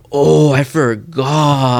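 very slow, drawn-out mock whine; imitated lame excuse. Friend mimics driver excusing his lie, drawn-out and mocking. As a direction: stretch each word out, mocking the excuse.

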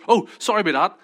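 high, loud, quick-reflex apology; apologetic. Retold or imitated. Speaker replaying his apology at the car rental, apologetic. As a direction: re-enact an over-polite sorry.

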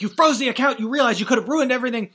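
high, loud furious yell, rushing the second line; imitating Zuckerberg screaming at him; screaming in anger. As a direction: scream the betrayal down the phone. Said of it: "he's being screamed at on the phone".

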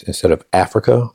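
ordinary delivery

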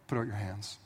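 soft, low, grave instruction; father binding his son; heavy quiet command. Retold or imitated. Abraham binding Isaac.

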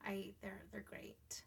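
soft whisper. Real, not acted. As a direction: hush it lovingly. Tender confession about kids.

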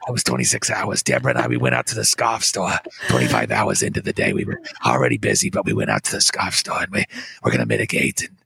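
detached and boring, full of hesitations; low droning official with long uhs; Fauci impression. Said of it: "but he's going".

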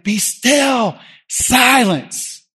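high, forceful command with falling ends; commanding rebuke. Acted. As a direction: call it out to quell a storm. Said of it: "Jesus gets up and he's like".

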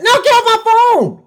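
high, loud brush-off of a pesky caller; annoyed friend. Retold or imitated. Annoyed friend snaps at a hyped caller, high and loud. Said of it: "annoyed friend answers the phone".

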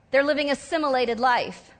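ordinary talk, nothing marked

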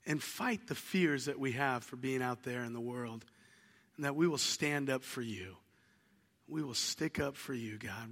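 soft, low prayer voice; hushed pastor's prayer; closing prayer. Acted. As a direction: pray quietly to God, reverent and hushed.

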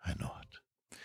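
a hushed, breathy aside; whispered I know it. Real, not acted. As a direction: drop to a whisper, sympathetic. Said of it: "sudden whisper aside".

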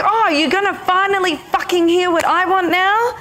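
high furious outburst; furious inner feminine. Retold or imitated. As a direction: lash out, finally heard. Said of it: "she was furious with me".

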